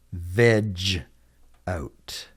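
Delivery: spaced-out "Veg out", dropping away on "out"; slow model repeat. Shown as a demonstration. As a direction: split "Veg out" into two separate words. Teacher repeats phrase slowly for learners.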